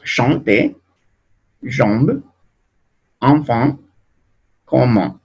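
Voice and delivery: chanter, jambe, enfant, comment, each nasal; repeated French nasal words. Shown as a demonstration. Teacher repeating the word list for students.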